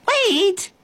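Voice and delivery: very high calling cry. A narrator's reading. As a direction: call after someone running away. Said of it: "the girl cried".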